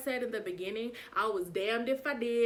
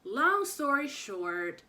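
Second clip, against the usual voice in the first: drawn-out aside; 6 semitones higher, 5 dB louder, much slower than usual. Real, not acted. Wrap-up flourish.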